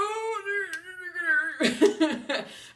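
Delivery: bright high burst of laughter; real high laugh. Real, not acted. Laughing across the lunch table at the gossip. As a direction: laugh out loud, amused.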